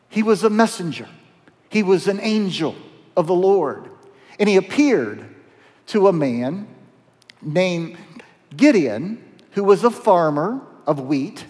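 ordinary talk

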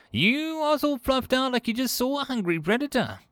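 high-pitched, concerned creature voice; worried small alien. Acted. Five Clicks asks why Kolch looks frightened, worried.

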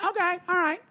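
high-pitched mocking woman's voice; high, put-on tipsy compliance; mimicked drunk woman. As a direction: imitate her in a high voice. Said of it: "the woman's like".

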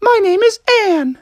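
high-pitched storybook maiden voice; dainty maiden. Acted. Maiden tells Froggy her name, high and dainty. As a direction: introduce yourself in a very high character voice.

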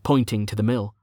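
ordinary delivery, nothing marked

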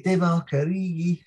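Divock Origi in a broad Scouse accent. Shown as a demonstration. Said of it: "the best name in a Scouse accent".

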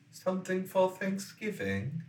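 high, exaggerated British begging; mock a posh British beggar; British accent. Mocking the begging kids.

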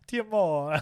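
high, loud mock Italian lilt; Italian accent. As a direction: sing out love in cartoon Italian. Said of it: "you sound like an Italian accent".

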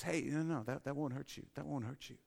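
hushed, coaxing voice of the brain; soft false reassurance. Retold or imitated. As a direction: coax softly, lying that it's safe.

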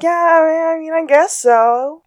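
mock grudging yes to Henry; sheepish Sean. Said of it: "Sean kind of is like".

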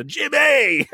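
hammed-up New York accent call; bad New York accent. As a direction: holler it like a New Yorker. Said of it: "worst New York accent I've ever done".

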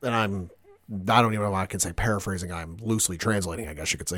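usual speaking voice

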